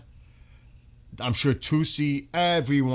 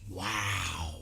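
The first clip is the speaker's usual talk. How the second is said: hushed awe; 6 semitones lower, 7 dB quieter than usual. Real, not acted. Awed at post.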